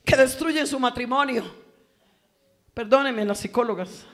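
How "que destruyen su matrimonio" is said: high, very loud revival shouting; booming, high-pitched exhortation; full-force preaching. Acted. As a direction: thunder it out to the congregation, drawing out the praise.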